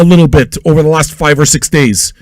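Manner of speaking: ordinary delivery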